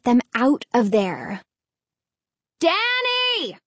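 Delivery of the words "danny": high call up to the window. Acted. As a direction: call his name up high. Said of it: "she called".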